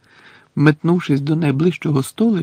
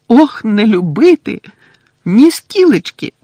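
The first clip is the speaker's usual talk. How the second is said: high, loud angry elf voice; angry house-elf; 9 semitones higher, 8 dB louder than usual. Acted. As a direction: squeak out her scorn. Winky angrily. (розлючена ельфійка)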